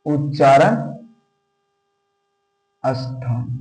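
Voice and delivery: उच्चारण then a long pause, then स्थान soft and low; dictating word by word with a gap before स्थान; split dictation. Shown as a demonstration. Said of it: "teacher dictates term again".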